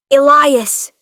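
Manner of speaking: loud screamed name. A narrator's reading. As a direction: scream after him in fear. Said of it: "I screamed".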